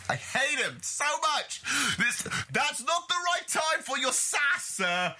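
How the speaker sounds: high-pitched outraged ranting; worked-up, high indignation; exasperated rant. Real, not acted. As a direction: vent your loathing, voice climbing. Ranting about the brother character.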